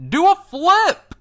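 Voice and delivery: loud high yell, drawn out; shouted from the crowd. Acted. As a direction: call it out over the crowd. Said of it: "you hear a voice call out".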